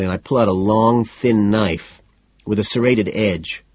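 usual speaking voice

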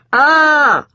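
high loud startled ah; waking shout ah; startled yelp. A narrator's reading. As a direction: jolt awake with a shout. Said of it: "the disgusted exclamation".

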